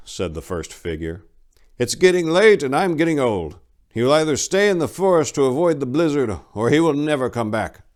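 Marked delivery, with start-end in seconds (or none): 1.8-7.8 aging monk, higher and louder, fretting; a tired elder fearing the worst; worried old monk. Acted. Old monk telling his companion the traveler may not return, worried.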